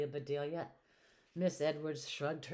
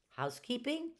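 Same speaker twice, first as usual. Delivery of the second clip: higher, questioning echo; surprised query; 8 semitones higher than usual. A narrator's reading. Doctor Dinglebat questions Amelia's housekeeping job, surprised. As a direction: lift it into a doubtful question.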